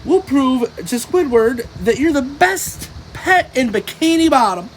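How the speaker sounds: high, eager cartoon sponge; high-pitched SpongeBob. A narrator's reading. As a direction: reassure your pet, bright and high. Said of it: "said SpongeBob".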